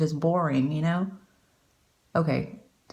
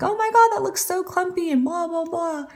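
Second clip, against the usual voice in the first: much higher, exaggerated voice for the viewer's "clumpy" gasp; mock gushing viewer; 11 semitones higher than usual. Mascara vlogger mocking viewers' clumpy complaints, high squeaky mock voice. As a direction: send up the dramatic viewer way up high. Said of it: "Everyone's like".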